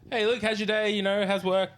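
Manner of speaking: high, loud chirpy small talk; energetic greeting. Retold or imitated. Gym manager asking about his day, energetic. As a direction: pester him with bouncy friendliness. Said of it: "be very energetic".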